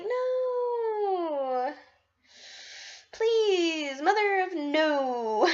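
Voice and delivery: high, whining please, dragged out slowly; whiny plea. Retold or imitated. Begging friends to stop scaring her, whiny slow plea. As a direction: beg them to stop, half crying. Pleading after being scared.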